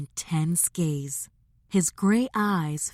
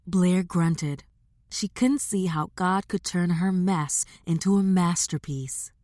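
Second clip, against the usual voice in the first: usual speaking voice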